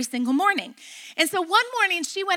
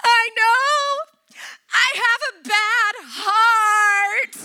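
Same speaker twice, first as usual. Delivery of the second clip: loud, high mock wail; hammed-up sobbing kid, wailing the last word; crying and sobbing; 8 semitones higher, 5 dB louder, slower than usual. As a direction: imitate a child bawling a confession, drawn out. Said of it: "she just is crying and sobbing".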